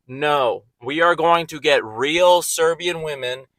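ordinary delivery

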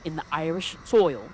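usual speaking voice

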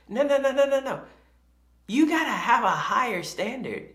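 high, loud rapid-fire refusal into firm insistence; emphatic correction. Retold or imitated. Dad correcting employees on standards, emphatically insistent.

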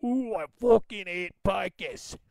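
put-on slow, drawn-out thick London accent; exaggerated London accent, slow and disdainful. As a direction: mimic a Londoner with a thick accent, taking your time. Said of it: "if you got a really thick London accent".

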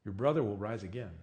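a preacher reading the gospel text; scripture reading. Acted. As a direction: read the passage with reverent care. Reads John 11 scripture aloud.

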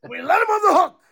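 high, shouting mock press-conference meltdown; coach rant impression. Quoting the coach's famous postgame rant, hammed-up shout. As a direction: yell it like a coach losing it at the podium. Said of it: "it's like".

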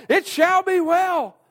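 It shall be well, high, loud and slow; crying out to God. Acted. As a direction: call it out to heaven, drawn out. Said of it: "are we saying".

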